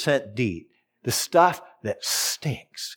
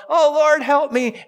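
mock desperate plea; 13 semitones higher, 5 dB louder, slower than usual. Imitating believer crying for help.

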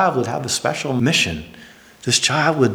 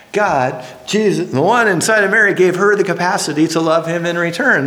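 emphatic sermon; 5 semitones higher than usual. Acted. Preacher's emphatic build.

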